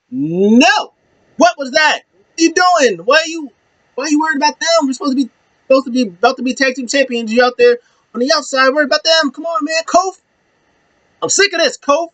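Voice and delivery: high-pitched, acted-out outburst at a partner; exasperated berating. Woods berates partner, retold.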